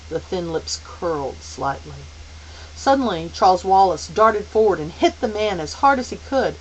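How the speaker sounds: usual speaking voice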